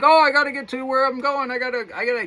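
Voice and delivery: mock frantic hurry to get somewhere; a touch of panicked rushing, quick and high; hurried self voice. Imitating rushed hurried self.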